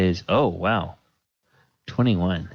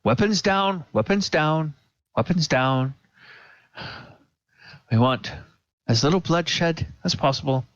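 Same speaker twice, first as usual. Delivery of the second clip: a frightened governor begging for calm, starting much higher; anxious governor; 7 semitones higher, faster than usual. Acted. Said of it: "hear the governor say".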